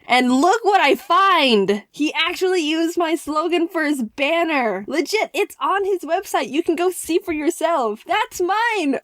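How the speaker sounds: high-pitched, thrilled bragging; happy excited. Real, not acted. YouTuber finding own slogan on his website, thrilled. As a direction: show off your discovery with rising glee. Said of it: "happy, excited tingles".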